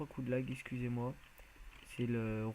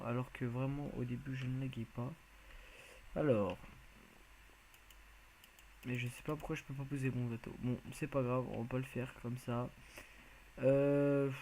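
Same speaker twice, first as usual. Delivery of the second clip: ordinary talk, nothing marked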